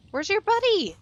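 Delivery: high, loud yell at a bee. Real, not acted. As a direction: shout up at the missing bee. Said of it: "I'm yelling at a bee".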